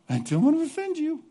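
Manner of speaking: high, simpering mock apology; mocking whiny apology. Mocking apologetic leaders in a high simpering voice. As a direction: whine it like a fake-sorry leader. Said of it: "they go back and say".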